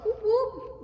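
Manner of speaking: high, loud cheer; excited whoop. Real, not acted. Cheering for her group.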